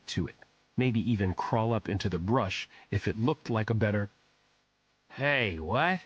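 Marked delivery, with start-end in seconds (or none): 5.1-6.0 slurred, lightly voiced drunk; a hint of a drunk bum; drunken man. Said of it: "a man's drunken voice said".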